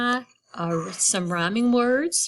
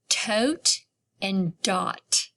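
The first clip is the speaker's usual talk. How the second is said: put-on drawn out for children to hear the sounds; slow demo words; slow, clear example words; much slower than usual. Shown as a demonstration. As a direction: stretch each word slowly. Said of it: "what about these two words".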